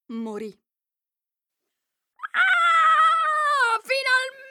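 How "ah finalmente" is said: very high, loud triumphant cry; malicious shout. Acted. Queen exults over the poisoned girl, evil triumphant shout. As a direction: scream your wicked victory. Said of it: "disse la regina con un grido maligno".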